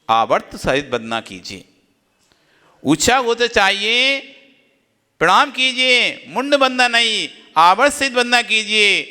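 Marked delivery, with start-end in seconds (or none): 2.8-9.0 higher, driving preacher's repeat; emphatic exhortation. Shown as a demonstration. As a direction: press each instruction home, pitched up. Repeats the same instructions forcefully. (ज़ोरदार, ऊँचे स्वर में उपदेश)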